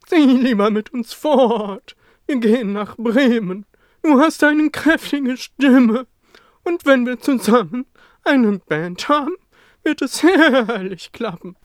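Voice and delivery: high and loud, rousing horse voice; hearty horse. Acted. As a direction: cheer the raven on with big enthusiasm. Horse cheers the raven.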